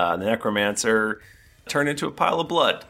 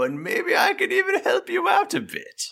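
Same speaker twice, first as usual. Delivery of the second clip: villain voice. Acted. Necromancer's offer.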